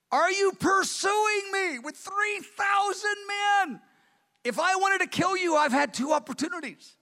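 David crying out to Saul from afar; pleading shout. Retold or imitated. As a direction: call out a desperate appeal. David pleading with Saul across valley.

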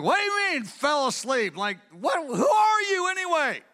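mock-indignant general, rising to a shout at the end; hammed-up affronted shout; indignant Abner. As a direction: play Abner taken aback and bristling. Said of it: "Abner's like".

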